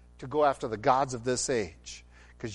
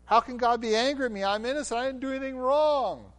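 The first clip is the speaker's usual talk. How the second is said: higher, whiny mock innocence; mocking whine; 10 semitones higher than usual. God mocking people who claim innocence, high whiny voice. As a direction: mimic a sulky self-justifier. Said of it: "God here is saying".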